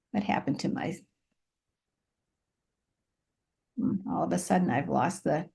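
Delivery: low, quiet talking to oneself; distracted aside. Real, not acted. Presenter who lost their place talks to self, quiet distracted aside. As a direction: murmur it softly while hunting for something lost.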